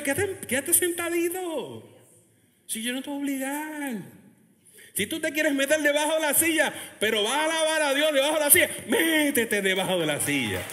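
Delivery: ordinary delivery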